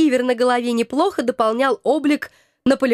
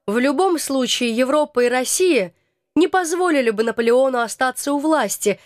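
ordinary delivery

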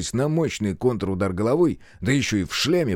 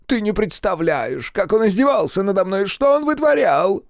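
high whine climbing louder; whining; 11 semitones higher, 4 dB louder than usual. A narrator's reading. Servant complaining of his master's abuse, whining. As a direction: wail the complaint, rising higher and louder. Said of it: "ныл соратник Ильича".

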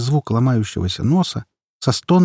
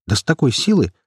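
ordinary delivery

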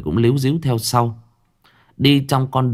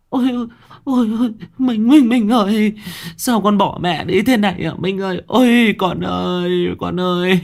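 loud wailing; 13 semitones higher, 3 dB louder than usual. A narrator's reading. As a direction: wail for your child like a bereaved mother. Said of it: "bù lu bù loa". (khóc lóc bù lu bù loa)

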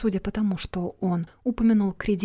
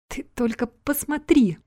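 slow, higher-pitched moan of dismay; groaning lament; 5 semitones higher, 3 dB louder than usual. A narrator's reading. Man under the car trunk moans over his loss, groaning. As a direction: groan it out, drawn out and high. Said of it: "простонал мужчина".

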